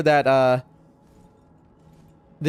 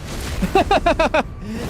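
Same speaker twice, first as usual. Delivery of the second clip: laugh; 10 semitones higher than usual. Real, not acted. Surprised laugh at teleporting enemy.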